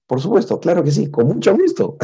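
eager delight. Retold or imitated.

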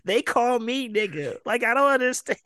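high, aggrieved mock protest; put-on wounded indignation; indignant. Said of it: "He was so indignant".

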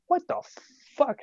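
high-pitched disbelieving outburst; imitated squad's baffled reaction; incredulous reaction. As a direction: retell it as stunned, high disbelief. Retold squad reaction to wounded comrade.

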